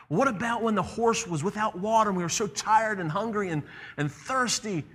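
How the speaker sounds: put-on high, plaintive child's protest; complaining boy. The boy complains of thirst and tiredness to the lion, complaining.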